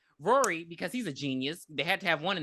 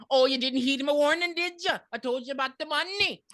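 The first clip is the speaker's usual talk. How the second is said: psychic character; 7 semitones higher than usual. Acted. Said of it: "so she's like".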